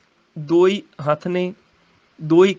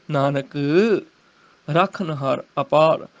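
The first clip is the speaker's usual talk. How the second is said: Nanak lower, then a long pause; pause after Nanak; 4 semitones lower than usual. Shown as a demonstration. Teacher showing the address to Nanak, pause after Nanak. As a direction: halt on Nanak before the phrase. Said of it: "Nanak ਤੇ ਰੁਕਣਾ ਹੈ".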